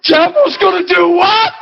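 rally the crowd; preacher firing up the room; incredulous shout. Real, not acted. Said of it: "do you hear all that reaction".

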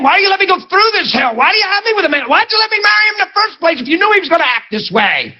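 high, fast-building exasperated plea to God; hurt complaining prayer. Acted. Wife begging God to change husband, acted exasperated complaint. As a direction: mimic an aggrieved wife praying. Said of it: "she's praying 'cause she's hurt".

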